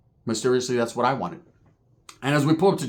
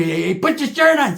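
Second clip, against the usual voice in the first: higher, louder, slow command; mock order; 7 semitones higher, 4 dB louder, slower than usual. Telling cousin to cover up, mock order. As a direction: bark it slowly at him.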